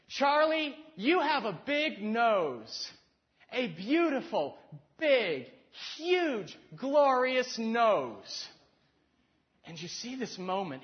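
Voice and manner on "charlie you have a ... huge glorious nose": high, loud, slow adoring woman; Daryl Hannah's adoring lines. Retold or imitated. Retelling Roxanne's balcony scene, Daryl Hannah's character gushing over his nose. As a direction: gush it up high and drawn out like a smitten woman. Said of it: "she stands there and she goes".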